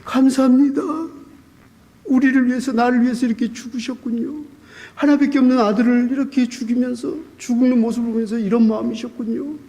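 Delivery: high, fervent thanks to God; grateful outpouring. Retold or imitated. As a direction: pour out thanks, overwhelmed. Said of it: "그러면서".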